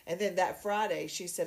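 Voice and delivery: ordinary talk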